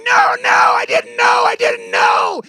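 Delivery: high, very loud wailing protest; anguished screaming. Retold or imitated. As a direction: scream it out in drawn-out torment.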